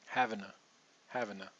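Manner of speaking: "Having a" with to reduced to a schwa. Shown as a demonstration. Teacher showing the reduced to, soft "having a". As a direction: drop the T of to, say "having a". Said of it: "let's try the other way".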